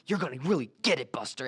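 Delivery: mock telling-off in a New York accent; New York lady scolding. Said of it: "she's like".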